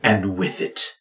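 grave ritual pronouncement; solemn father. Acted. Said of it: "your father speaks".

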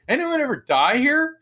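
high-pitched, incredulous asking; pointed question. Retold or imitated. Asking the restaurant staff about deaths, high and pointed. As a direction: put it to the room, pitched right up. Calls question to bartender and waiters.

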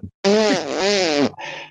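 laughter jumping up high then falling away. Real, not acted. Guest laughing at the host's invitation, high laugh.

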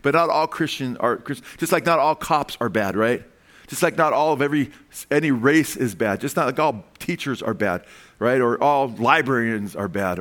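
ordinary delivery

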